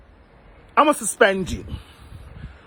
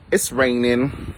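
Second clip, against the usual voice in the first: deep drawn-out voice; 10 semitones lower, slower than usual. Pretend. Drops into deep voice.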